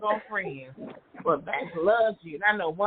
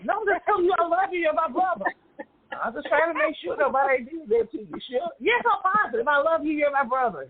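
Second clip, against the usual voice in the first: high, pleading reassurance; earnest reply to brother; 9 semitones higher than usual. Retold or imitated. Telling the brother she loves him, high and earnest. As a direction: insist you just love him, pitched up. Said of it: "I'm like".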